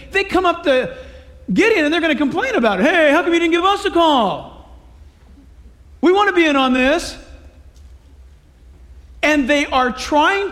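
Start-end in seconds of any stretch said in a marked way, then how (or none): 2.8-7.2 whiny complaint. Retold or imitated. Ephraim complaining to Gideon.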